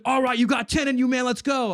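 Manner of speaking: high, loud imitation of a spotter; hyped-up shout. Drunk neighbor spots his bench press, hyped-up shout. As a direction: shout it like a pumped-up workout partner.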